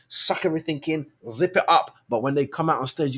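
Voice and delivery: usual speaking voice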